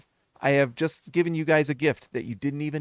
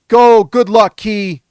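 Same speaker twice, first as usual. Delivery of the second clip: loud; 4 semitones higher, 11 dB louder, slower than usual. Real, not acted. Cheering a viewer on.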